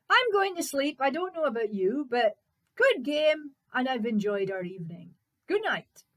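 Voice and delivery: high, loud, matter-of-fact hero voice; undaunted Fritz, breezy goodnight. Acted. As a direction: sign off cheerily to a giant. Fritz bids giant goodnight.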